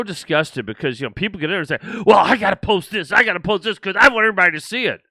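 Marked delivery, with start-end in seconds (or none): 2.1-5.0 higher, louder mock-insistent poster; mock whine of someone desperate to post; mocking imitation. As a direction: imitate a pushy, childish poster. Mocking group members' posting excuses.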